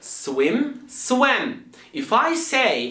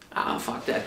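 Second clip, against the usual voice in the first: whispered dismissal; 7 dB quieter than usual. Acted. Friend gives up in skit.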